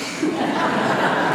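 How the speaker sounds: real airy laugh at the memory; breathy laugh. Real, not acted. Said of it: "and I started laughing".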